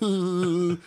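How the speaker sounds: drawn-out gleeful "ooh"; teasing mock "ooh". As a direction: imitate onlookers goading a friend. Imitates the group's teasing reaction.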